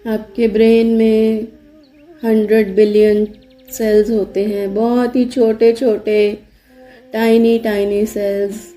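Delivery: even, unhurried meditation voice with long pauses; guided meditation. Acted. Yoga teacher leading listeners to relax body and brain, guided meditation. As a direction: guide them steadily into relaxation, leaving space after each instruction.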